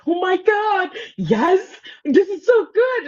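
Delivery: high excited gush; thrilled gushing. Retold or imitated. Audience member's thrilled reaction.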